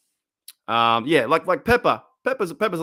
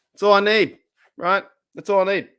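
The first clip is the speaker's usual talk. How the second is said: excited; 6 semitones higher, 5 dB louder than usual. Real, not acted. Excited about ideal woman.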